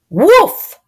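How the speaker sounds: high, loud woof; dog bark. Acted. Dog sound for children.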